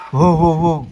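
loud, sharp hoo calls at the dog; loud calling. Real, not acted. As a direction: call the dog loudly.

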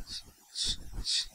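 quick unvoiced sniffs; sniffing noise. Imitating a cokehead at the donut counter, sniffing. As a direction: snort it up like a user.

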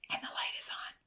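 very soft breathy whisper. A narrator's reading. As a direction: whisper so no one inside hears. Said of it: "Franz whispered".

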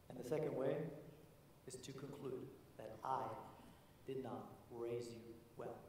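slow, soft, grave; solemn grandfather. Retold or imitated. Grandfather gently explaining his conclusion, soft and slow. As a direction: speak the lesson quietly and deliberately.

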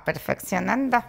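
Deyanira character voice. Shown as a demonstration. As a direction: slip into your comedy alter ego. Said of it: "ni hablaba así ni nada".

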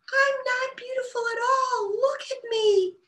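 high small wail, slowing at the end; wailing tiny voice. A narrator's reading. The bluebell wailing to Bella, high tiny wail. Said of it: "the voice wailed".

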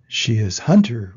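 loud storybook roar, kept low; mild lion's roar. A narrator's reading. Lion arguing about what God is, mildly roared. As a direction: roar it lightly like a lion in a read-aloud. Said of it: "roared the lion".

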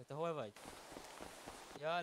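usual speaking voice

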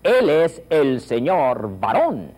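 big enthusiastic classroom drill. Shown as a demonstration. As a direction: savour every sound with gusto. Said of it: "you must say Spanish with enthusiasm".

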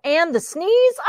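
usual speaking voice

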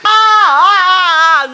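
loud high sobbing wail; wailing sob. Acted. Mother overcome by grief, loud sobbing wail. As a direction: break down into loud sobs.

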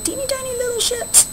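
high, cute mock-baby voice; cutesy tiny voice. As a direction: make the ships sound adorably small. Teacher mocks the tiny ships.

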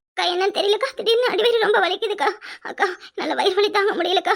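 sobbing through stomach pain; tearful, in pain. Acted. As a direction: plead tearfully with sobs between phrases. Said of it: "ஏன் அழுதுகிட்டு வந்து உட்கார்ந்திருக்க". (வலியில் அழுதபடி)

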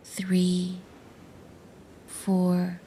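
soft, steady count with long pauses; guided breathing count. Shown as a demonstration. Narrator demonstrates the breathing process to listeners, soft spaced guided count. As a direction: count out each breath softly, evenly spaced. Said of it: "The breathing process goes like this".

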